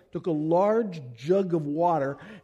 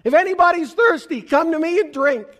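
shouted high and loud; preacher voicing Jesus crying out to the festival crowd, loud yell; 11 semitones higher, 9 dB louder, faster than usual. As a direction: call out to the whole crowd. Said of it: "in a loud voice, he yells out".

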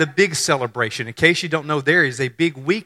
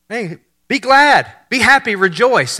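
slow, laid-back, higher greeting; chilled angel; 7 semitones higher, 5 dB louder than usual. Retold or imitated. Preacher imitating the angel as chilled, slow and high. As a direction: stretch it out, easygoing. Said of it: "he's just chilled".

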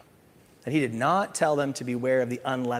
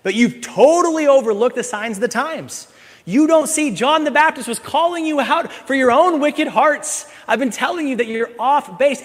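higher, louder scolding Jesus; rebuking Pharisees; 7 semitones higher, 7 dB louder than usual. Pretend. Jesus scolding the Pharisees for missing the signs, loud rebuke. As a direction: call them out, building in heat.

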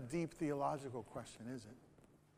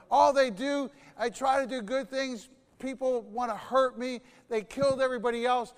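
loud, much higher shout of refusal; furious quitting; 7 semitones higher, 12 dB louder than usual. Acted. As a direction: yell that you're done with it all. Elijah quits, shouting at God.

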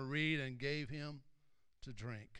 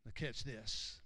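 ordinary delivery

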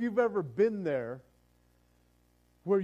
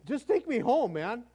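louder, higher fed-up cry to God; exasperated plea; 5 semitones higher, 5 dB louder than usual. Retold or imitated. Overwhelmed believer crying out to God, loud and exasperated. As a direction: throw up your hands at God. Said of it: "it's like".

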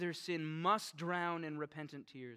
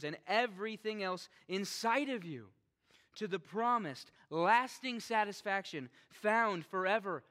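impassioned preaching; 6 semitones higher, 8 dB louder than usual. Real, not acted. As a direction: preach it with rising fire. Preaching at full force.

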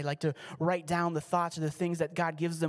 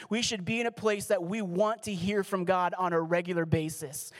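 normal talking voice

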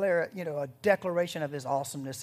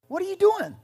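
much higher and louder, indignant; indignant driver; 10 dB louder than usual. Retold or imitated. Driver caught littering protests the trooper, high and indignant. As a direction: play the outraged driver. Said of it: "the guy goes".

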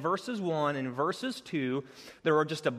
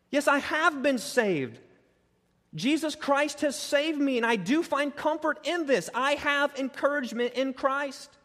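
high, full, urgent sermon voice; preaching; 9 semitones higher, 3 dB louder than usual. Acted. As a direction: drive the truth home to the congregation.

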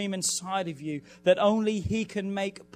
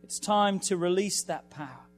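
preaching at full force, higher and louder; 4 semitones higher, 4 dB louder than usual. Acted. Pastor urging the congregation before the fast, full-force preaching. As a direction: drive it home like a revival preacher, rising.

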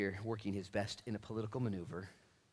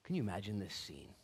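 ordinary delivery

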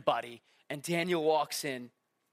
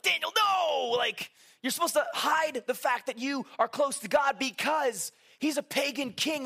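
"Daniel, no!" shouted in mock alarm; 7 semitones higher than usual. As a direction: yell at him to stop, panicked.

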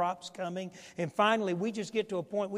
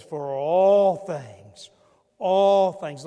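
loud, slow scripture proclamation; preaching; 11 dB louder, much slower than usual. Acted. Pastor proclaiming Paul's words, loud and slow. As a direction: proclaim each word slowly and loud.

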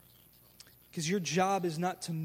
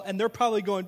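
normal talking voice

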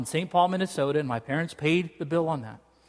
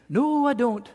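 higher, lightly Scottish mom; Scottish accent; 6 semitones higher, slower than usual. Retold or imitated. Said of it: "She gets a Scottish accent".

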